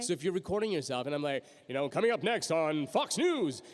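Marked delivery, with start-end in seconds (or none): 1.9-3.6 slow, drawn-out news promo read; announcer read. Shown as a demonstration. Voice actor demonstrating practice recording, announcer read. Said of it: "That's not what I think I sounded like".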